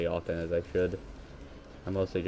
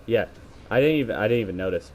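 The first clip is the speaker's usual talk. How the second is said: normal talking voice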